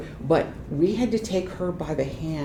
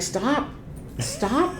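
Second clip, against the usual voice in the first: retold protest; 8 semitones higher than usual. Imitating telling touchy mom off.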